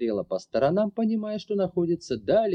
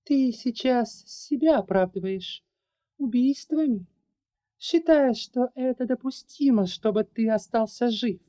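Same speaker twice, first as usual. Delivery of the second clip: higher, indignant questioning; 10 semitones higher than usual. Acted. Larry confronting the man over his excuses, indignant. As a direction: demand answers, outraged. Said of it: "возмущённо проговорила Ларри".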